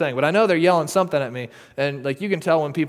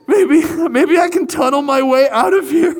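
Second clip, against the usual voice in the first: high, loud laughing near-sob; high loud laughing wail; laughing cry; 10 semitones higher, 10 dB louder than usual. Real, not acted. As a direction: laugh through remembered terror. Terrified on the ground.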